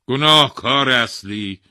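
loud, slow royal verdict; pronouncing king. A narrator's reading. King passing judgement on the thief, imperious. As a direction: rule on the case loudly, weighing each phrase.

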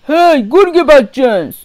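loud woodcutter's yell; shout. A narrator's reading. Woodcutter spots the wicked wolf nearby, shouting. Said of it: "فریاد زد".

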